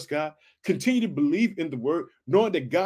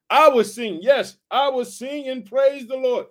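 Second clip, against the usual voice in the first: loud, high cry of praise; excited praise; 7 semitones higher, 5 dB louder, slower than usual. Acted. Preacher voicing David's vow to sing, loud and high. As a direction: lift it up like a song of joy.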